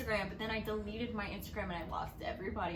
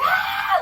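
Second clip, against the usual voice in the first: loud high-pitched shriek; high squeal; 16 dB louder than usual. Retold or imitated. Two fans freaking out after Matthew left, loud shriek. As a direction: shriek with excitement. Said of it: "we were just like".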